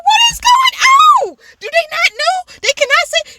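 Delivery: very high, loud incredulous cry; hammed-up bewildered outrage; retold outraged disbelief. Acted outrage at TV show.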